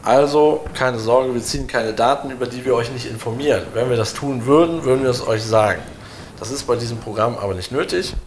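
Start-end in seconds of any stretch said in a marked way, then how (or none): none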